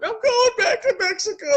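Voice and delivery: high, far put-on voice of a weepy elderly poster; mock crying old person. Old person crying on Facebook, mocked in a high whiny voice. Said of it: "crying on Facebook".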